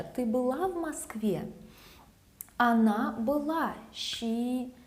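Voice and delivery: ordinary delivery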